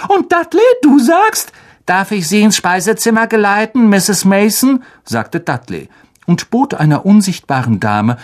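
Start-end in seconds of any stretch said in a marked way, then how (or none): 0.1-1.5 very high, eager mother's voice; prompting aunt. A narrator's reading. Aunt cues her son. 1.9-4.8 put-on high, rehearsed polite boy voice; gallant little son. A narrator's reading.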